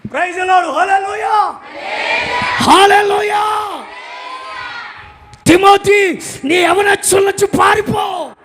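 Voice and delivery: high, loud, slowed preaching shout; loud, high, slowed fire-and-brimstone preaching to the congregation; fiery preaching. Acted. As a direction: preach it out at full force. Preaching at full force.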